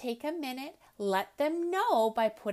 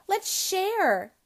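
happy; 8 semitones higher, 7 dB louder than usual. Acted. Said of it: "said Ellie happily".

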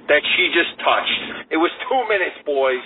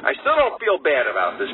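ordinary talk, nothing marked

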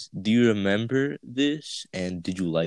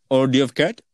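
louder mock Indian accent, questioning; 3 semitones higher, 6 dB louder, faster than usual. Prank caller asking a gas station, Indian accent. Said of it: "in an Indian accent".

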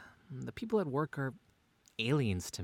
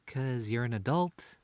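ordinary talk

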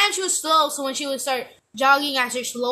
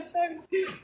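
a whimpering, softer mock cry; whiny cry; 3 semitones higher, 7 dB quieter than usual. Girl imitating herself whining to her partner, mock crying. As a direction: whine it like you're about to cry. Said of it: "I'm like".

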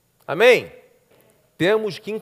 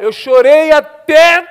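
slow, louder, stretching chorei até; drawn-out emphasis; 10 semitones higher, 13 dB louder, much slower than usual. Retold or imitated. Raquel's testimony retold, slow and emphatic. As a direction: lean on every word slowly. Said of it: "o que me marcou foi o seguinte".

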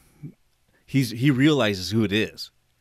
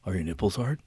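low, softer mock-seductive voice; creepy prank caller; 4 semitones lower, 6 dB quieter than usual. Prank caller pressing the confused man, mock-sultry. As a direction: play a hushed dirty phone call.

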